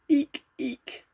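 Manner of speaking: very high-pitched little eeks; tiny high squeals; hamster squeak. A narrator's reading. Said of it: "Even the hamster said".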